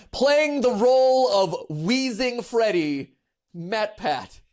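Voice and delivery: high, loud, slow trailer-announcer delivery; dramatic announcer voice. Pretend. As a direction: announce it like a movie credit. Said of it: "I could be the wheeze voice for Freddy".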